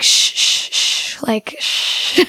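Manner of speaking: put-on gentle whispered shh, like calming a baby; soothing shushing whisper. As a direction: hush them softly, a sweet spirit's whisper. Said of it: "like, really sweet voice".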